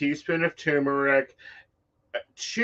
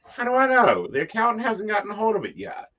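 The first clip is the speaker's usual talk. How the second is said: mock exasperation; 8 semitones higher, faster than usual. Mock answering form again.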